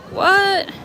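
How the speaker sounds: sharp high startled what; surprised. Real, not acted. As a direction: blurt it out in disbelief. Confused by F1 track comment.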